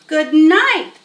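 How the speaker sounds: loud high scream of disgust. Acted. Boy screaming at the stinking barn. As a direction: yell it out, shocked by the stink. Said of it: "screamed Avery".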